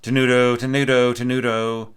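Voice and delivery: "tenuto" held and sustained, loud and half-chanted; tenuto articulation. Shown as a demonstration. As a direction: lean into each "tenuto" like a held note. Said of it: "other players are going tenuto".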